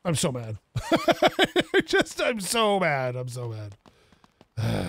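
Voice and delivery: low, chuckling through the words; laughing mock anger. Real, not acted. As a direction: say it while laughing at your own mistake. Laughing at his own wasted money.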